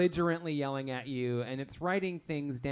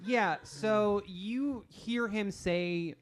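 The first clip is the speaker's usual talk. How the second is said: normal talking voice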